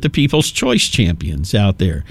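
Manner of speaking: ordinary delivery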